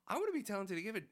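put-on eager kid voice. Child pleading, retold.

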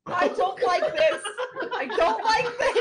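high, loud objection through laughter; loud laughing protest. Real, not acted. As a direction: cry out in amused distress. Protesting loudly at the Shrek bit.

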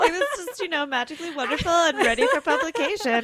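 high, sing-song sarcasm; sarcastic gush. Acted. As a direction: gush over it mockingly. Sarcastic teasing of easy publishing.

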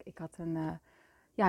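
normal talking voice

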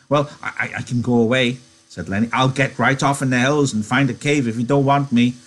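high, eager simple-minded giant; Lenny voice. Acted. Lenny offering.

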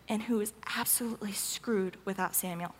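soft breathy whisper; whispered aside. Real, not acted. Aside about Saul's helplessness.

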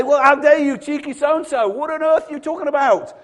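high, loud indignant telling-off; mock angry God. Preacher as God, scolding cheeky Abraham, loud and high. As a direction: scold him like an outraged parent. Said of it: "And I'd be arguing".

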